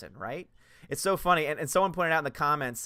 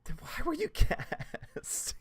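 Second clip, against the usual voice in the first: incredulous; 8 semitones higher, 4 dB quieter than usual. Real, not acted.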